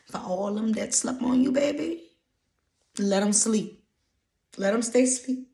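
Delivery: loud, high, lilting encouragement; rousing hype. Real, not acted. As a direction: lift it up and belt it out. Hyping listeners against those who doubted.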